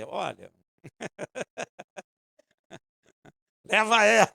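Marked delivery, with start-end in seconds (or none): 3.6-4.3 loud, punchy joke reply; hammed-up Jesus answering; mock divine answer. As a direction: hit the punchline hard. God's joking reply.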